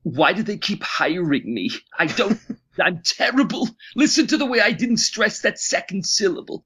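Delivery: high, put-on whiny voice, mocking himself; mock anguished self-critique; self-critical inner voice. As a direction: whine at yourself like your own harshest critic. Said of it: "all I can think is".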